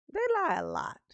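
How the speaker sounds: повторяет «They lie a lot» выше и с нажимом. Real, not acted.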